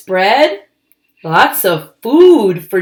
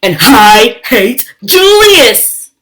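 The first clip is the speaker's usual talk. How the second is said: defiant; 3 semitones higher, 8 dB louder than usual. Acted. Lily declares hatred.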